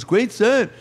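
high-pitched, lilting star impression; high mimicked celebrity voice. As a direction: greet in a high put-on voice. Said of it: "Paul McCartney's here".

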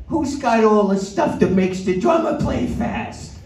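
high, slow put-on official voice; customs man. Customs man questioning the band at the airport, high mock voice. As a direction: draw it out in a high-pitched character voice. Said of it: "the customs man was looking for my bag".

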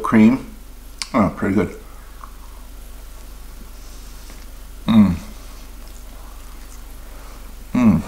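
chewing while talking; muffled through food; mouth full. Real, not acted. Seen 2: Bites donut at 0.6–1.5, then chews with donut held at mouth.

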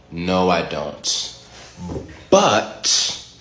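ordinary delivery

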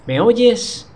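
high loud call down the line; shouting. Acted. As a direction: shout to be heard on the phone. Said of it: "no está susurrando, que digamos".

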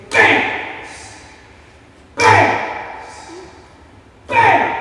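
high, explosive imitated bams; loud banging noise. Imitating the frightening kitchen noise, loud separate bams. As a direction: blast each bam out on its own. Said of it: "really loud noise".

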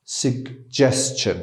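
suggestion said slowly and softly, 'sug-jes-chen'; slow, soft pronunciation. Shown as a demonstration. Teacher giving the final slow model of the word. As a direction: draw out suggestion gently, sounding the ch.

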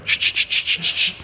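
imitated aerosol spray bursts; repeated short spritzing hisses; hairspray sound. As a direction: make the noise of spraying hairspray. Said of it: "soak this first row with that hairspray".

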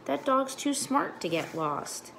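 put-on high, slow little-girl voice; lightly voiced small girl; child's holler. A narrator's reading. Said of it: "Sweetie Pie holler".

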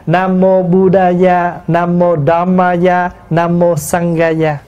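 slow, even "Buddhaya", "Dhammaya", "Sanghaya"; Sanskrit recitation. Shown as a demonstration. Monk reciting the three original lines, slow even Sanskrit "Dhammaya". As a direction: intone "Nam Mô Sanghaya" slowly in Sanskrit syllables. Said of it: "ba câu đó là phiên âm từ tiếng Phạn".

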